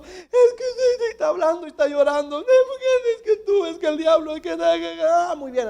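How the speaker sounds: hammed-up crying chatter; mock crying while talking. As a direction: act a girl blubbering and talking at once. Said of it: "Llora y hablando, llorando y hablando".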